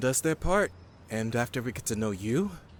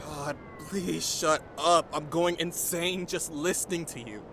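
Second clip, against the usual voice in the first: exasperated; 6 semitones higher, slower than usual. Acted. Annoyed by chatter.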